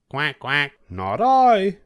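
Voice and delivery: a duck quacking then refusing. A narrator's reading. Duck refusing to help the hen in a high voice. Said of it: "said the duck".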